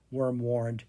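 plain reading voice

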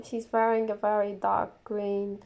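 ordinary talk

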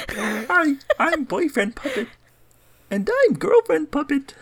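high, squeaky puppet voice. Acted. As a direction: talk like a little hand puppet. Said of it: "that's my puppet voice".

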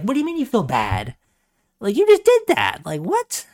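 high, disbelieving outburst; mock-outraged, pitch shooting up on did; incredulous outrage. Friend mocks the apologizers, incredulous and high. Retelling shocked reaction to apology.

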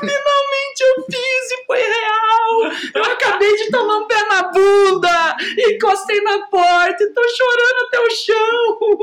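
high, loud mock sobbing like a telenovela heroine; sliding down the door after the breakup, mock crying; hammed-up soap crying. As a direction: wail it out as a melodramatic soap breakdown. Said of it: "a cena de escorrer pela porta chorando".

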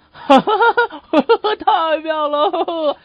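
put-on high, loud child's cackle into drawn-out words; loud mock laughing. Nephew delighted by the hat trick, hammed-up loud laughing. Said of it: "他就一边笑一边说".

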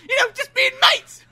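high, squealed comic excuse; mock indignant shriek. Sherlock insisting they are just mates, high mock protest. Character protesting the shower accusation.

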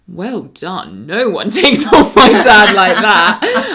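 retold praise, laughing loudly through it; loud and laughing, slow on the second line; laughing quote. Friend's brother praising the speaker after a dinner-table debate, laughing. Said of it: "linked me in arm with arm and goes".

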